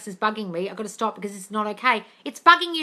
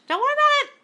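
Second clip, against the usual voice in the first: sing-song squeal; 7 dB louder than usual. Acted. Coach mocking comment, sudden high voice.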